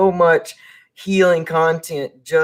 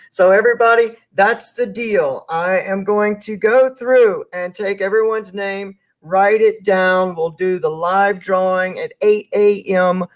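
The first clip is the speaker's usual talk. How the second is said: bright, raised host announcement voice; upbeat host sign-off; 4 semitones higher than usual. Acted. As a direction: announce it like a show host wrapping up. Host wraps up the live show.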